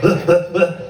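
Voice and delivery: loud, short comic grunts; mock sex grunts. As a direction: grunt in punchy bursts, hammed-up. Mimics sex grunts again.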